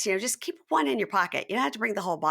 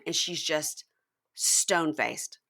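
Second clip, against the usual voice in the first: ordinary delivery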